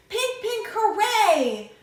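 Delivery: high, loud cheer; joyful shout. A narrator's reading. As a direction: squeal it out as a hooray. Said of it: "Then I shouted".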